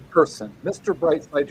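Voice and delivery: ordinary talk, nothing marked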